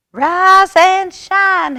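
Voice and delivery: very high, loud, slow frog call; frog shout. A narrator's reading. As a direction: blast it out like a tiny alarm. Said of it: "he shouted".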